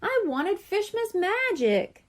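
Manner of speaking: high, slow, drawn-out whine; whining. A narrator's reading. Coral whines to Papa about Fishmas magic, high and slow. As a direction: whine it like a disappointed child. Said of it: "she whined".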